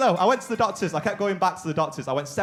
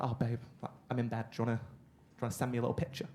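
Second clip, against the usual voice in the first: much softer, sweet persuasive murmur; soft coaxing girlfriend; 3 semitones lower, 10 dB quieter, faster than usual. Retold or imitated. Girlfriend texting from bed, soft and coaxing. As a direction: coax him gently from bed. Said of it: "She's like".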